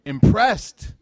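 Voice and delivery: "impressed" thrown up high and loud; excited emphasis. Retold or imitated. Neighbors eager to impress the Prophet, a high excited burst.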